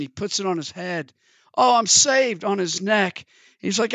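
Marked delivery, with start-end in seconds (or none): 1.6-2.4 excited. Acted. Foolish man celebrates.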